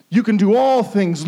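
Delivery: ordinary talk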